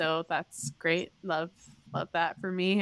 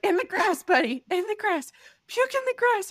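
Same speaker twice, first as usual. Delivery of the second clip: put-on high, louder coaxing to a child; urgent coaxing; 12 semitones higher, 5 dB louder than usual. Mother urging her son to puke, high and urgent. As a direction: coax him quickly, pitched high.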